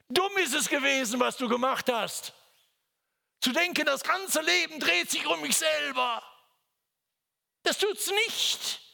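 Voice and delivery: high, loud scolding; preacher berating self-pity, rising to a shout; stern rebuke. Real, not acted. To the self-pitying listener: high, loud stern rebuke.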